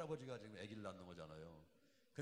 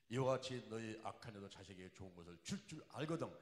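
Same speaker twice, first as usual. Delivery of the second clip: loud, ringing preacher's recitation of scripture; scripture preaching; 7 dB louder than usual. Acted. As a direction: proclaim the verse to the congregation. Quotes scripture at full force. (힘차게 성경을 선포하듯)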